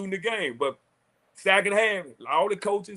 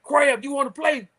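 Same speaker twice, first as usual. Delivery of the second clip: retold coach challenge; 9 semitones higher than usual. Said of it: "He was like".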